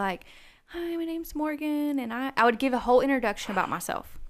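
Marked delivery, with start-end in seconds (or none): 0.7-2.0 high, slow, timid hello; shy new teacher introducing herself; nervous voice. Retold or imitated. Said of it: "I had this really nervous voice".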